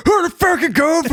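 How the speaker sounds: very high, strained mocking screech; angry yell back. Comedian yelling at his friend's wife who called, high incredulous screech. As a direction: hurl the question back in a shrill outburst. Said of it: "got to yell at your wife".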